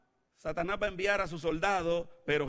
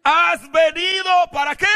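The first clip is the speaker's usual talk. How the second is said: very high, loud shout; demon's cry; 12 semitones higher, 14 dB louder than usual. Acted. Demon challenging Jesus before the congregation, crying out. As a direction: shriek the question as the demon. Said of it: "le dice el demonio".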